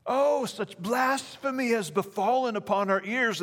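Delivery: put-on high-pitched, theatrical English accent; hammed-up outrage in an English accent; mock English accent. Preacher mimicking scribes and Pharisees hearing blasphemy, mock English accent. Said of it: "like that with an English accent".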